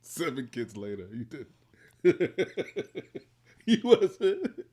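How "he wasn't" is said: high, loud words through laughing; real laughter. Real, not acted. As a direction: crack up while saying it. Amused at her not liking him.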